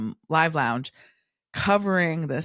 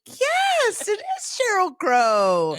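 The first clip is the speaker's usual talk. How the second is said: high, drawn-out celebration of a right answer; mock triumphant cheer; 4 dB louder, much slower than usual. Quizzer telling a friend she guessed it, mock triumph. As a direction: cheer the lucky guess in a long high whoop.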